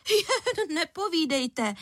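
high, slow, put-on sarcasm; mock-amazed indignation; scornful disbelief. A narrator's reading. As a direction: scoff in an exaggerated high voice. Angry mother scoffs in disbelief.

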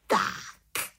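contented little witch exclamation; satisfied exclamation. A narrator's reading. Little witch at midnight, ready to begin, satisfied. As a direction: call it out with satisfaction. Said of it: "spokojeně zvolala malá čarodějnice".

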